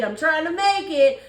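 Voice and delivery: ordinary talk, nothing marked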